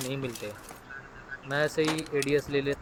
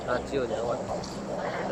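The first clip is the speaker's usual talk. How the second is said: Haryanvi accent; 5 semitones lower, 4 dB quieter, much slower than usual. Shown as a demonstration. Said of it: "थोड़ी सी Haryanvi tone में बोल दिया यार".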